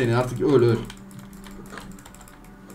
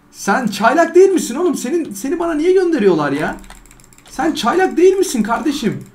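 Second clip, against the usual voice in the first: 1 indignant disbelief; 11 semitones higher, 3 dB louder than usual. Real, not acted. Mocking trainer who beat him.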